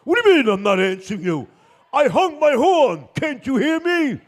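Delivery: loud, high angry shout; exasperated yelling. Acted. Car driver to the unhearing bus driver, exasperated shout. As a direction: shout to get through to him.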